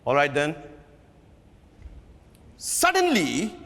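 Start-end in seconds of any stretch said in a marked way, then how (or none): none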